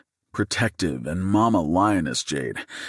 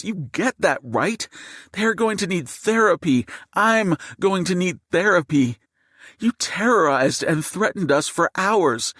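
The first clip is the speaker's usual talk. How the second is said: high, outraged tirade; shouting; 12 semitones higher than usual. A narrator's reading. Mother berates gunman over her traumatized children, shouting. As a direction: let fly, voice pitched way up. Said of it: "and she's shouting".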